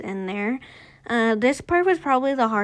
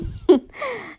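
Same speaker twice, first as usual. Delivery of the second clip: high burst of laughter; 10 semitones higher than usual. Real, not acted. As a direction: laugh at yourself. Laughs after imitating friend.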